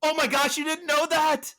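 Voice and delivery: can't believe she never knew; shocked disbelief. Real, not acted. Host stunned her co-host missed the actor, high shocked exclaim.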